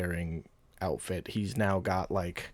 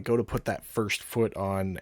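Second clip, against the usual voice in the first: ordinary delivery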